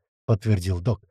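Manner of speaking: plain reading voice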